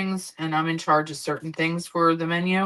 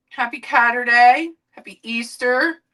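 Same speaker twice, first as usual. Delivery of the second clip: pet talk; 5 semitones higher, 5 dB louder than usual. Real, not acted. Greeting the cat.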